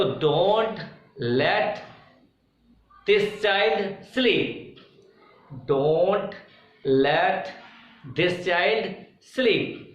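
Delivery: बहुत धीरे, एक-एक शब्द खींचकर; छात्रों को लिखवाते हुए धीमे-धीमे बोलना; slow dictation. Shown as a demonstration. Dictating to students. (शिक्षक छात्रों को वाक्य लिखवाते हुए, बहुत धीमे)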